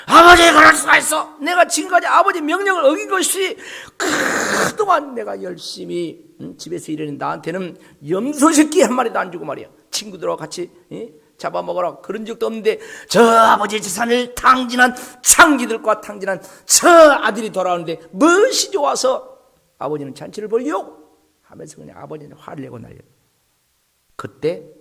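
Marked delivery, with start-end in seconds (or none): 0.1-3.6 a loud, higher, hammed-up furious protest; angry shout. The elder son bursting in on his father, furious acted shout. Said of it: "화를 내면서 집에 와가지고 아버지한테". 4.0-21.1 angry complaint. Retold or imitated. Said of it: "화를 내면서 집에 와가지고 아버지한테".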